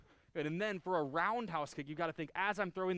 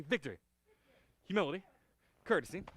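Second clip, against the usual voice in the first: sharp martial arts commands; 3 semitones higher, 5 dB louder, faster than usual. Shown as a demonstration. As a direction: call each word out crisply. Opening of the form.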